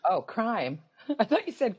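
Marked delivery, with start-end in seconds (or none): none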